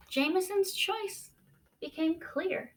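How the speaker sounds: ordinary delivery, nothing marked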